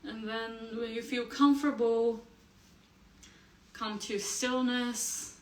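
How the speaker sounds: slow, spaced-out meditation guidance; guided meditation. Acted. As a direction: settle listeners gently into stillness, pausing between phrases.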